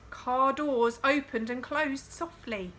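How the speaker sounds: ordinary delivery, nothing marked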